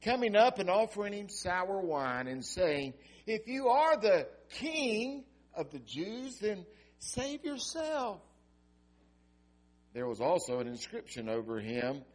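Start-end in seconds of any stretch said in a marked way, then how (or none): 3.3-8.2 high, slow taunting sneer; mocking soldier. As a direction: drag out the taunt.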